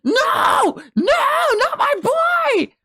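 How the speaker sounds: high, loud mock wail of grief; anguished cry. Fan reliving the panel, wailing in high anguish. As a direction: cry out as if your hero just died. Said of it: "at the end of that panel being like".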